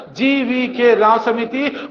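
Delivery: GVK राव समिति slow and deliberate; slow emphatic drill. Shown as a demonstration. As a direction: hammer the name in slowly. Teacher drilling committee name. (धीरे और ज़ोर देकर)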